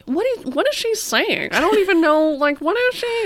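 high, swooping talk-show host; Drew Barrymore. Acted. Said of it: "that was a really good Barrymore".

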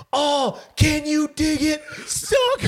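high, drawn-out catchphrase shout; Booker T. Retold or imitated. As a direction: belt it out like a wrestler's entrance, soaring at the end. Imitates Booker T's catchphrase shout.

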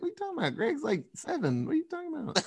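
high, hushed squeak of disbelief; mock baffled protest. Son protesting to mom about Greg, squeaky mock disbelief. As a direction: protest softly in a high kid's voice.

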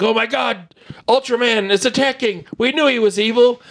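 high-pitched mock alarm; hammed-up panicked outcry. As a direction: imitate an alarmed crowd, pitch jumping up. Voices panicked crowd in film.

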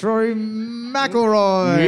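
slow, stretched game-show reveal; drawn-out host reveal. Pretend. Quiz host drawing out the answer to players. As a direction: announce the name like a show host.

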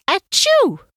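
high loud achoo; puppy sneeze. A narrator's reading. Sick Max sneezes in bed, a high loud achoo. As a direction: let out a big puppy sneeze. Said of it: "and sneezes".

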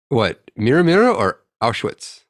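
normal talking voice

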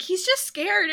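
lightly voiced kind cab driver; reassuring [name]. [name] reassures about the horse.

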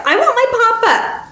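put-on very high childish wail; high-pitched, childlike cry; girl crying out. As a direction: demand it like a distressed little girl. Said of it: "the girl cried".

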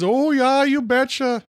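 exaggerated Minnesota accent, mocking a TikTok girl. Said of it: "she does this over-the-top Minnesota accent".

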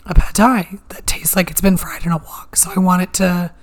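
sore throat, barely voiced; whispered with a raspy throat; hoarse whisper. Real, not acted.

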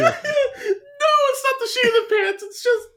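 laughing through words; words breaking up with laughter. Real, not acted. Laughing fit after joke.

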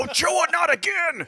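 high, drawn-out shouted scolding; loud mock outburst. Golf announcer berating Ochoa in a spoof, loud high shout. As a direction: cry out slowly in mock exasperation. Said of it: "so loud".

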